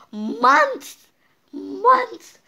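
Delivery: high, loud astonished cry; shocked shout. Real, not acted. Kid shocked that dust storms last months, high loud shout. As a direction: blurt it out in disbelief, twice.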